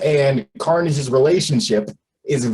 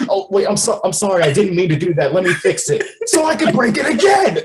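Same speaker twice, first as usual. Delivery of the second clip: high, fast mock apology; fake-sorry, then rising high; Venom's voice; 4 semitones higher, faster than usual. Venom mocking an apology to Eddie, high and fast. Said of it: "being like".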